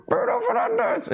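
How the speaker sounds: very high, slow squeaky mock voice; mock fan answer. Imagined viewer answers host's question, squeaky mocking voice.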